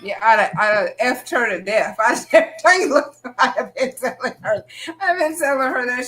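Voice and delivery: high, loud, laughing outburst; real laughing. Real, not acted. Host jokes about cursing out the mother-in-law, laughing. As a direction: laugh through the words, pitch climbing.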